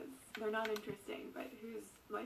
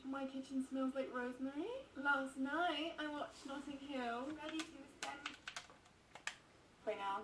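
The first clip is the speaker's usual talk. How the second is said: light mock British accent, a touch higher; 3 semitones higher, 8 dB louder, slower than usual. Vlogger mimicking a British accent while making toast, mock British accent. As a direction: put on a playful British accent for the viewers. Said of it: "Let's switch to British".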